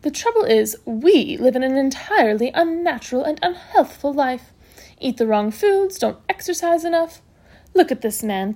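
emphatic lecture with relished adjectives; brisk matron, admiring. Acted. Matron praising her patient's health, admiring and emphatic. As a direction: hold forth in lively admiration.

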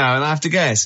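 normal talking voice

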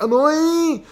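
very high, loud "oy"; long high-pitched cry of pain, mimicked; imitated pain yelp. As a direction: imitate a hurt kid's yelp. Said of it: "But this was like".

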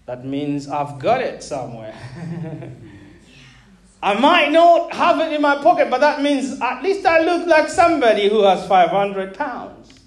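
ordinary delivery

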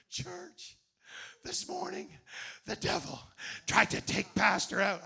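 a pretend revival-style alarm to the church; mock pulpit proclamation. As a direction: ham up the dramatic pulpit warning. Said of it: "didn't go to the pulpit and say".

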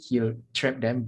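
ordinary talk

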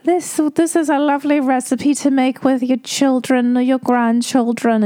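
high, sweet grandmotherly voice imitating Mary Berry; Mary Berry impression. Mocking Mary Berry's gentle tone to viewers. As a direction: lilt it up like a kindly old baker. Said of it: "And Mary is like".

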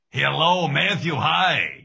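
put-on high, loud old man answering the phone; grandfather impression. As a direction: greet him like a grandpa on the phone. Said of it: "a certain rhythm to how".

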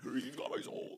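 high, soft, strange possessed voice; possessed squeak. Pretend. Joking about the exorcist film, high and eerie.

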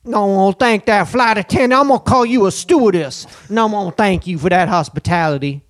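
loud, high mock New Orleans accent; big, showy New Orleans twang; put-on New Orleans accent. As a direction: ham up a New Orleans local. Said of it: "I'm talking like that".

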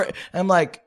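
ordinary talk

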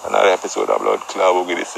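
normal talking voice

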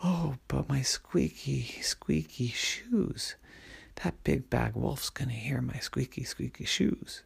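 put-on frightened little girl whispering; scared whisper. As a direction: hush it so the wolf won't hear. Helen fears wolf will hear.

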